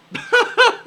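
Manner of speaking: squealing high giggle; high giggling laugh. Retold or imitated. Daniel still giggling on stage, squealing high laugh. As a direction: imitate a helpless high giggle.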